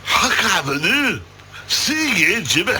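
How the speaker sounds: lightly put-on Scottish accent; a half-hearted Scottish barfly impression; a hint of mock Scottish. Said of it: "your pathetic attempt at a Scottish accent".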